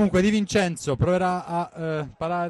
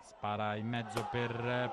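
ordinary talk

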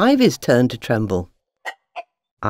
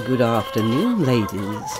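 low, sly fox voice; 5 semitones lower than usual. Acted. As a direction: greet them slyly, pitched low. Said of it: "he said slyly".

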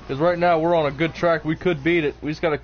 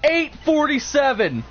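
high, excited victory call; triumphant announcement; 9 semitones higher, slower than usual. Real, not acted. Player announces a beaten record to teammate, triumphant cry.

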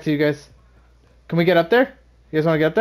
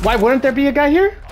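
startled, pitched-up question; surprised; 8 semitones higher, 3 dB louder than usual. Real, not acted. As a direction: caught off guard by an enemy. Surprised by enemy in fight.

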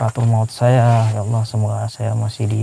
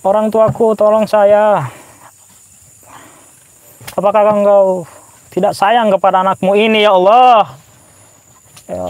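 pleading dead man; 6 semitones higher than usual. Pretend. Corpse begging God and parents for life, high wailing plea. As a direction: beg God and family to save you. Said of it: "Saya coba gambarkan perasaan mayat".

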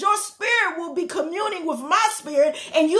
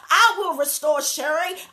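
normal talking voice

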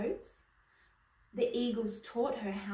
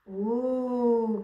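long, louder ooh of wonder; lightly acted cry of delight; cried-out ooh; 7 dB louder than usual. A narrator's reading. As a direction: draw it out like an admiring onlooker. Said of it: "cried Josephine".

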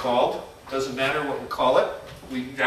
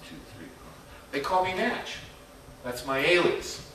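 ordinary talk, nothing marked

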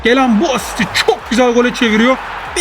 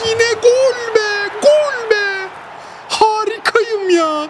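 much higher, rising with delight on bak; excited; 9 semitones higher, much slower than usual. Real, not acted. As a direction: gush over the pass, voice leaping up. Celebrating another goal.

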